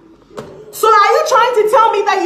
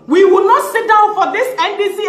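ordinary talk, nothing marked

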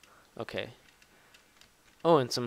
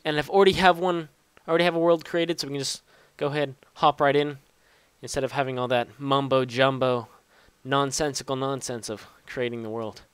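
ordinary talk

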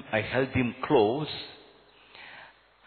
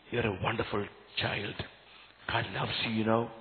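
put-on softer, tender words to a child; soft reassurance; 5 semitones lower, 8 dB quieter than usual. Priest telling the boy God loves him, softly tender. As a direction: hold him close with your voice.

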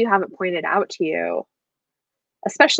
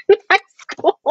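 laughing; 12 semitones higher than usual. Real, not acted.

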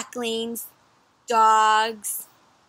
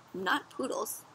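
plain reading voice

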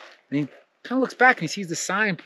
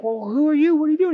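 much higher, jittery questioning; nervous high questions; 11 semitones higher, faster than usual. Acted. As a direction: ask like you're spooked. Traveler nervously questions demon.

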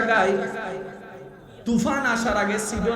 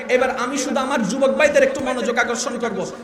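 ordinary talk, nothing marked

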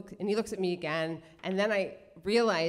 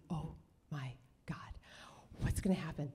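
hushed, barely voiced thrill; excited whisper; 5 semitones lower, 10 dB quieter than usual. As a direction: whisper it in secret anticipation. Secret thrill as notebook travels.

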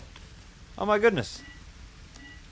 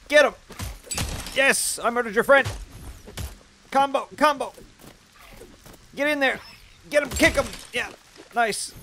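excited cheering; 11 semitones higher, 4 dB louder than usual. Real, not acted. Cheering pet fighting spider.

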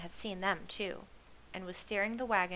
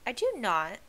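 indignant, higher and louder denial; indignant retort; 3 semitones higher, 7 dB louder than usual. A narrator's reading. Lizzie answering Torrin's teasing, indignant. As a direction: snap back at a teasing remark.

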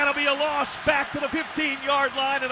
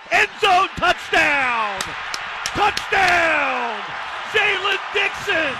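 exultant touchdown call; 3 semitones higher, slower than usual. Real, not acted. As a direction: belt out the score to the fans.

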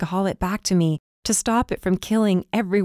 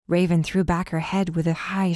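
ordinary delivery

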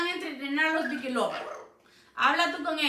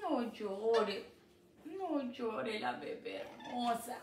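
soothing; 6 dB quieter, slower than usual. Real, not acted. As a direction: soothe the crying bird gently. Consuela al loro llorón. (voz suave y lenta de consuelo)

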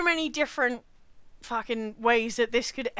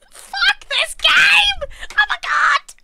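very high, loud screech; shrieking gamer outburst; rage scream; 9 dB louder, much slower than usual. Real, not acted. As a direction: scream it slowly in real fury. Rage at the game.

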